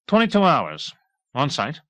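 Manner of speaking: officer justifying himself; defensive. A narrator's reading. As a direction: prickly answer. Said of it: "he answered defensively".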